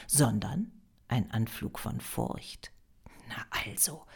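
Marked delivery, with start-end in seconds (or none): 3.3-4.1 soft, low inner voice; hushed, low aside to self; private thought. A narrator's reading. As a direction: think it quietly to yourself. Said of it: "dachte Maria".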